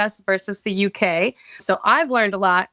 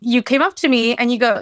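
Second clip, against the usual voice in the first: ordinary delivery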